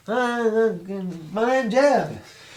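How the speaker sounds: high and slow, dragging out Jeff; slow dopey greeting. Acted. Player character greeting the guards, slow and high. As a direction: say it like a simple giant, very slow. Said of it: "And I just say".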